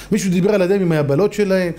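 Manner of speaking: usual speaking voice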